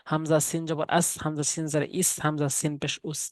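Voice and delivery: ordinary talk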